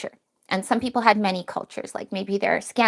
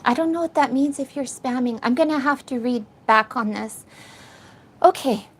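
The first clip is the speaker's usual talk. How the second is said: usual speaking voice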